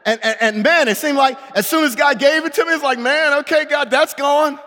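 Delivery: loud, high, driving sermon voice; fervent preaching. Acted. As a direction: preach it out full force. Preaching at full force.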